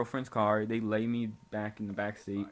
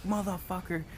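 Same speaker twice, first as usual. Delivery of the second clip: high, pained mock curse at normal volume; retold cussing; 8 semitones higher than usual. Passenger whose ankle badly hurt, mock pained cussing. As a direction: re-enact cursing everybody out in pain. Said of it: "cussing everybody out".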